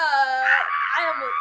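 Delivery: loud, high, drawn-out cry; mock scream. Fan reacting to the idol's line, screaming. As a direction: scream it out, stretched long.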